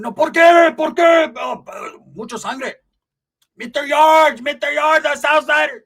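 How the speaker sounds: high, loud pleading yell, shocked and hurt; panicked shout. Acted. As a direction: cry it out after a punch to the mouth. Punched paisa yelling at attacker.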